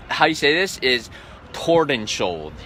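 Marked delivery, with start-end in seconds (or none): 1.5-2.5 Tordenskjold in an American English accent. Shown as a demonstration. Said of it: "accent, an American English accent".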